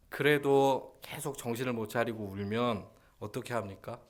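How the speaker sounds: normal talking voice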